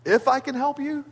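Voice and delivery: incredulous, pitched high on the second if; mock disbelief, rising high; almost sarcastic. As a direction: throw the question back with a sarcastic edge. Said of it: "challenges him almost sarcastically".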